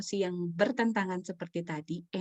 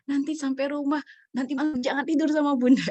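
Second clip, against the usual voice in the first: higher mock scolding; mock parental threat; 7 semitones higher, 6 dB louder than usual. Mother to tantruming child, mock threat. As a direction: imitate a mom's stock warning. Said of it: "kata-kata populer tuh gitu".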